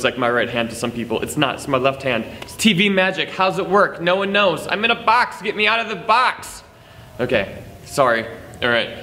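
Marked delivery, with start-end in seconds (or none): 2.4-6.6 higher-pitched playful clowning; mock trapped in TV. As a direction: pretend to be stuck in a box, pitched up high. Clowning about TV magic, trapped in box.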